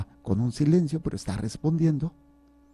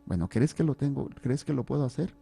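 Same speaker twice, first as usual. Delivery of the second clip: ordinary delivery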